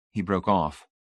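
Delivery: ordinary delivery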